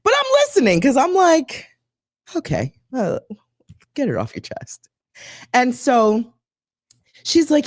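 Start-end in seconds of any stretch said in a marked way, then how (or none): 2.4-4.9 low, soft, dry go-ahead; quiet mock-patient aside; sarcastic patience. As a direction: humor her with a tired shrug in the voice. Sarcastic thought while listening.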